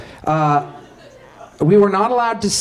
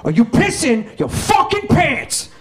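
menacing gangster; 4 semitones higher than usual. Retold or imitated. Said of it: "he's just like".

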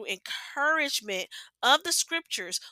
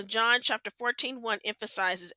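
ordinary talk

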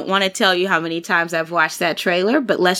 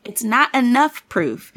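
ordinary talk, nothing marked

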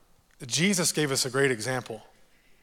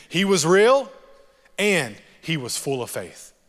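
"real" punched higher and louder; stress on real; 3 semitones higher, 6 dB louder than usual. Real, not acted. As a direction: hit "real" hard, then ease down on "and he was full of faith". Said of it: "I'm gonna say it again".

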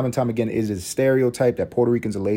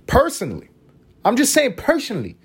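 much higher playful squeak; squeaky aside; 12 semitones higher than usual. Pretend. Defending his coffee and tobacco joke in a squeak. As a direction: chirp it like a cartoon aside. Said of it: "aside in high voice".

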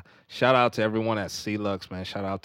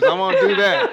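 loud and high, laughing through it; 12 dB louder than usual. Real, not acted. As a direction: blurt it out while cracking up. Teasing about names, laughing loudly.

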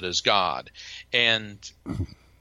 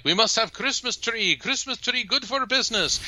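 high, put-on Middle Eastern shopkeeper voice; Omar's voice; 11 semitones higher than usual. Boss insisting to employee, in a high put-on voice. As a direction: lively boss insisting on a tree. Omar voiced in retold story.

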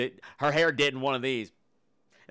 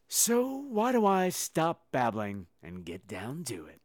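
ordinary talk

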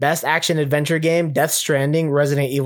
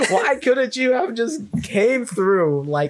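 acted exasperation; 7 semitones higher than usual. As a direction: whine in outrage at the bad timing. Gamer berating girlfriend for interrupting.

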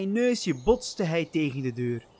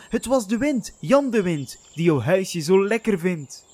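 loud, high, hasty reply; boy talking fast to cover up; quick answer; 4 semitones higher, 5 dB louder than usual. Acted. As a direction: blurt out the excuse quickly. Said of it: "Hans antwoordde snel".

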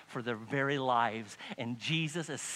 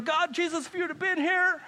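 high, mock-tearful plea; hammed-up high lament; weeping plea; 13 semitones higher, 4 dB louder than usual. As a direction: imitate a grieving, wailing reproach. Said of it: "they're weeping".

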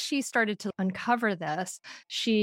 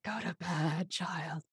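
tired, low, dropping away; weary command; 4 semitones lower, 8 dB quieter, slower than usual. Retold or imitated. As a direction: sigh it out, worn out after movie night. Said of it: "I'm just like".

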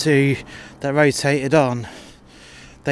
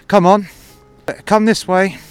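higher, louder sarcastic summons; raised mock politeness; 7 semitones higher, 7 dB louder than usual. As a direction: loudly usher him off with fake courtesy. Confronting rude customer, mocking.

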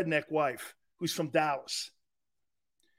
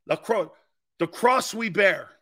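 normal talking voice

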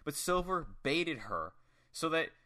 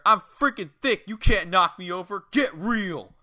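higher, much louder cocky yell; shouted heel taunt, pitched up; imitated yell; 4 semitones higher, 9 dB louder than usual. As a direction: yell it like a boastful wrestler mocking an opponent. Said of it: "then yells at her".

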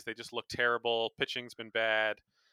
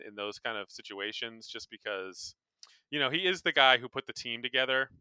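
normal talking voice

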